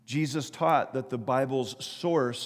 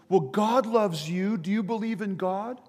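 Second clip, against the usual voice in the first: higher-pitched, acted calling; 6 semitones higher than usual. Bystander reasoning with man about to jump, calling out. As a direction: call up earnestly to someone far off.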